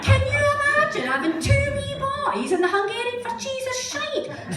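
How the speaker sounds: high, loud exclamation of disbelief; loud comic outrage; incredulous. Real, not acted. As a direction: pitch it way up, marvelling at the absurdity. Incredulous aside to the audience.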